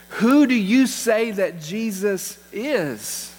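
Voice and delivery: very slow, each word weighted; probing the congregation, drawn-out question; slow emphatic question. Real, not acted. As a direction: lean in and ask it slowly. Pastor presses the key question.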